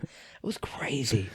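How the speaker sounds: slow whisper; whispered aside. Real, not acted. Telling his friend about the bus, he whispers slowly. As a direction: whisper it like a secret.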